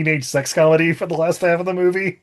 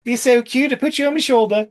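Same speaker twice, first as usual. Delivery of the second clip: mock Aussie accent; 8 semitones higher than usual. Mock Aussie wildlife host.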